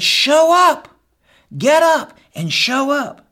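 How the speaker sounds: high, loud cry to the men; preaching shout. Real, not acted. Preacher rallying husbands, loud and high. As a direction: call them to get up, full force.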